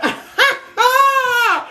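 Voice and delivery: high, drawn-out laugh close to crying. Real, not acted. Card reader overcome by a message from Spirit, high tearful laugh. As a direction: laugh that rises into a long high wail. Struck by what Spirit showed her.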